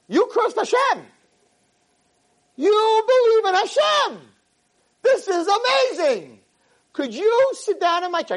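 very high-pitched, excited cry; amazed exclamation. Retold or imitated. Acting out his amazed revelation to girl.

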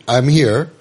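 normal talking voice

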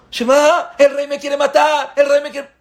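muy alto y fuerte, asustado en broma; pánico fingido. Retold or imitated. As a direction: grita con miedo exagerado. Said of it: "Y tú". (el súbdito cree que el rey lo quiere matar, agudo y asustado)

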